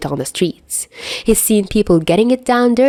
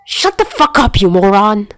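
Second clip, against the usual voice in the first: yelling; 3 semitones higher, 3 dB louder than usual. Acted. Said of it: "he yells".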